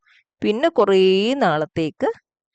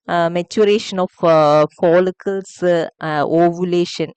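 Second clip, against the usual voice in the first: slow listing; 2 semitones lower, 4 dB louder, much slower than usual. Shown as a demonstration. Teacher listing past topics slowly.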